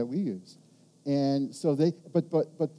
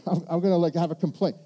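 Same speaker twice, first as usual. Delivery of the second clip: ordinary talk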